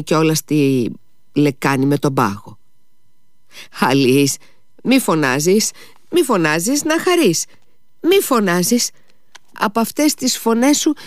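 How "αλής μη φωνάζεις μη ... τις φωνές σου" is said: high, agitated begging to stop; climbing, fretful repeated plea; flustered pleading. A narrator's reading. Mother begs Lise not to shout, flustered.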